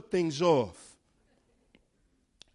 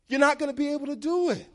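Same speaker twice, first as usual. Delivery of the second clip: higher, fast, driven home; emphatic preaching; 11 semitones higher, 4 dB louder, much faster than usual. Real, not acted. A preacher warns listeners they cannot copy Abraham, fast emphatic push. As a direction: press the point hard.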